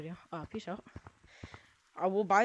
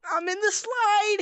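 excited yell; 12 semitones higher, 8 dB louder, slower than usual. Real, not acted. Yelling while sliding down.

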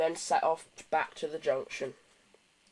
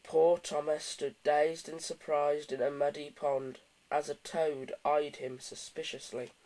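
plain reading voice

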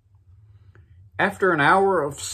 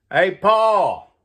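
loud, high holler across the yard; raised-voice call to someone out back; 8 semitones higher, 7 dB louder than usual. Acted. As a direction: holler out for Paul. Son calls to Paul out back.

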